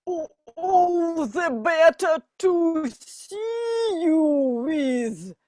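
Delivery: a hint of a squeaking fake granny; squeaky disguised wolf. Wolf in Granny's bed answering the girl, lightly squeaky. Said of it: "squeaks the wolf".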